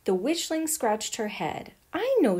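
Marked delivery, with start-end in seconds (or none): 1.9-2.4 sudden idea, higher and louder; excited cry. A narrator's reading. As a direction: blurt it out with delight. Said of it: "she cried".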